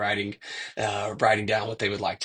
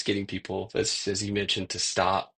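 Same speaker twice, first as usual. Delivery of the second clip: ordinary delivery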